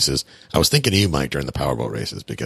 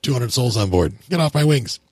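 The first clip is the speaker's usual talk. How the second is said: higher-pitched pretend airline pilot; mock pilot's complaint; 8 semitones higher than usual. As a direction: play a pilot shooing small aircraft away, pitched up. Playing annoyed airliner pilot, joking.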